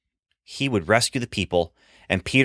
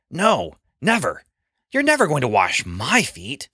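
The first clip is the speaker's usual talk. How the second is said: firm refusal; 5 semitones higher than usual. Acted. Peter refuses Jesus.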